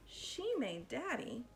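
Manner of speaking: plain reading voice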